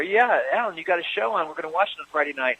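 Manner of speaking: high, eager, quick fan imitation; excited fan voice. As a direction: gush like a fan who just heard the news. Fans excited about the show.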